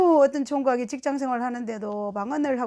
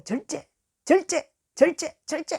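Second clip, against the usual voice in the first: high, repeated urgent command; urgent restraining; 5 semitones higher than usual. Retold or imitated. Calming an overexcited woman in tongues, urgent restraint. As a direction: rein someone in with insistent, raised repeats. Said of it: "막 절제를 시켰어요".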